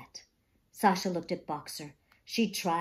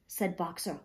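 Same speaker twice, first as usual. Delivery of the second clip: ordinary delivery, nothing marked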